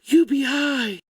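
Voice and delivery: high, put-on squeal of delight; hammed-up high-pitched enthusiasm; mock excitement. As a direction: cheer it like a giddy fan. Mocking fans excited about UBI.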